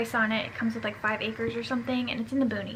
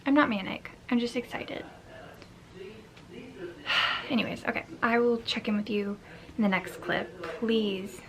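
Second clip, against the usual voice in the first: normal talking voice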